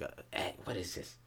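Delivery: whispered, stoned puzzlement; stoned king puzzled by his eggs, whispering. Acted. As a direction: breathe it out, baffled and stoned.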